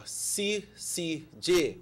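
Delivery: C C J said very slowly, letter by letter. Shown as a demonstration. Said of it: "say it a little bit slower".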